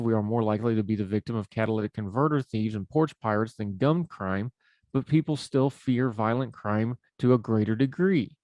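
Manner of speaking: ordinary delivery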